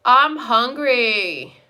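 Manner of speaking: hungry whine. Real, not acted. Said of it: "You're making me hungry now".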